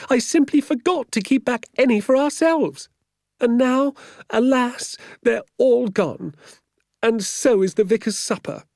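high, loud lamenting woman; wailing. A narrator's reading. Woman confessing she forgot the birds, wailing. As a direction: keep up a comic high-pitched wail. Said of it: "she wailed".

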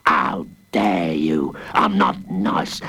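low offended growl; low, slow indignation. A narrator's reading. Warden growling at being called nice. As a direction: growl it out slowly.

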